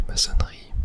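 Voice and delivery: ordinary delivery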